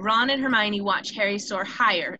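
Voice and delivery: usual speaking voice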